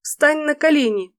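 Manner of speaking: commanding heroine. Acted. Фэй приказывает.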